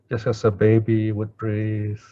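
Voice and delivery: normal talking voice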